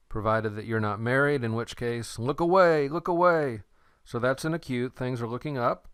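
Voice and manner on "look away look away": higher, louder playful warning; mock warning. Teacher jokingly warns students off someone married, mock warning. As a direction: hurry them off with a joking alarm.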